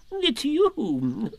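high, fussy insistence; a flustered owl insisting. Acted. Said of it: "getting quite fussy".

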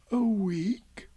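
whispered dismay; gloomily. Acted. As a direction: breathe it out in despair. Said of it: "said Pooh gloomily".

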